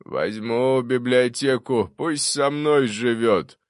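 grand, slow, drawn-out voice; self-satisfied old ghost; pleased ghostly ancestor. A narrator's reading. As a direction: declare it loudly, stretching every word.

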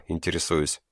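usual speaking voice

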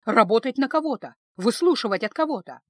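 usual speaking voice

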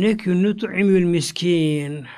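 drawn-out Quranic chant of the verse; slow Quran recitation; slow Arabic recitation. Acted. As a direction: recite the Arabic slowly, as in prayer.

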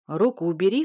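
loud booming order; booming command. Acted. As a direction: boom it like a warrior king. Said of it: "гремит рядом голос короля Ингвара".

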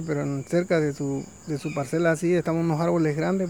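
usual speaking voice

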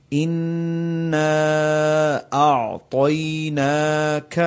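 slow chanted recitation of اِنَّا أَعْطَيْنَاكَ, each letter distinct; careful Quran recitation; slow tajweed recitation. Shown as a demonstration. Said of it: "ہر مخرج الگ الگ ادا ہو".

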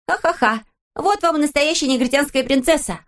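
put-on high, laughing girl's cry; joyful shout. A narrator's reading. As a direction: crow with glee. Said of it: "радостно закричала Пеппи". (радостный крик девочки)

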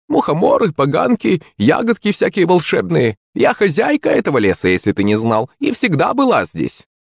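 high, boastful crone voice; old witch voice. Acted. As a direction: brag like the forest hag. Witch boasts of her forest.

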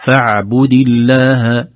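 فاعبد with a clear, middle ain; listen to the ain. Shown as a demonstration. Teacher telling students to listen to the ain. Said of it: "انتبهوا لصوت العين".

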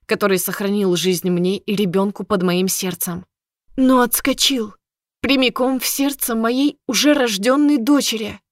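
ordinary delivery

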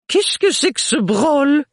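loud, high fussy mother; bossy matron pushing her daughter; overbearing mother. Acted. Mother voiced again.

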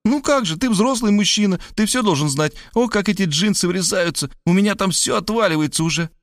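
put-on high whiny girl voice, chatty and aggrieved; young woman Varvara, high and complaining. A narrator's reading. Varvara whining to Rob about her tight jeans, high.

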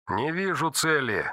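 tense call through a radio. Acted. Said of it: "Голос четвёртого звенел от напряжения".

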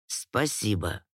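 low, soft man's voice; soft low lordly thanks; lord's voice. A narrator's reading. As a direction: thank her quietly, pitched well down. Lord thanks the fairy.

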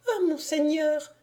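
high, lightly put-on lady; lady's voice. A narrator's reading. Lady pretending to notice the lord, high voice. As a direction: exclaim high, as if just noticing.

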